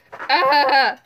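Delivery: loud sudden shriek; startled yelp. Real, not acted. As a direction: yelp as if jumped at.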